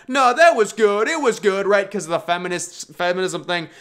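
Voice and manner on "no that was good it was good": imitating fans defending the movie; mock insistence. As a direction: overdo the polite insistence. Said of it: "people would be like".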